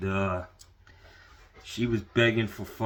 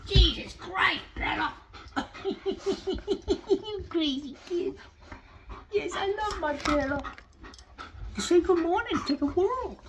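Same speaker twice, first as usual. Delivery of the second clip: high, doting pet voice, louder when calling; 4 dB louder than usual. Real, not acted. Owner fussing over and calling his dog, high doting baby talk.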